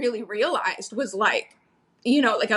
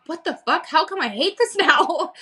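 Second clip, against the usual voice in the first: a higher, slowed-down disbelieving groan; exasperated self-quote; 6 semitones higher than usual. Retold or imitated. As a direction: play yourself baffled at your own unhappiness. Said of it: "And then you're like".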